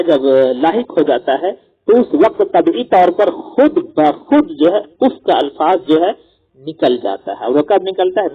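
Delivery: usual speaking voice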